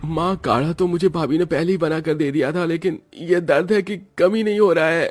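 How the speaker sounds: lightly voiced sick young man; ailing son. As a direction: complain to mom that the ache won't go. Narrator voices sick son Saurabh.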